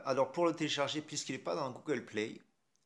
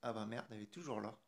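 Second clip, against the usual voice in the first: normal talking voice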